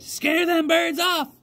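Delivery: put-on high, slow old lady order; old country woman. A narrator's reading. Old lady tells the scarecrow rabbit to scare birds, high and slow. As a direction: drag it out like a cranky granny. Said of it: "she said".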